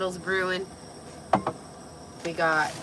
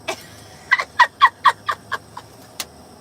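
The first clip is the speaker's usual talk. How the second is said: high giggle; 9 dB louder than usual. Real, not acted. Tipsy giggling fit.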